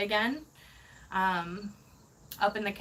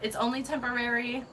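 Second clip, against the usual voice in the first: ordinary talk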